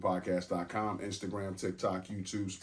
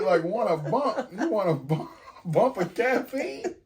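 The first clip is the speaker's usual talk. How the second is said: high laughing mock question; teasing, laughing; 12 semitones higher, 9 dB louder, slower than usual. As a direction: tease her with a laugh. Mock repeating the phrase.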